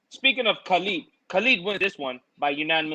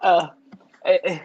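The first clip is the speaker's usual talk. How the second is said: normal talking voice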